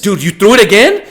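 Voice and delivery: can't believe he did it again; shocked disbelief. Real, not acted. Fighter throws the same kick again.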